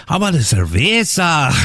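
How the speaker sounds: high, overdone Spanish on "cerveza"; mock Spanish accent. Diner ordering from the waitress, mock Spanish accent. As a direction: show off a put-on Spanish accent. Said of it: "And they're like".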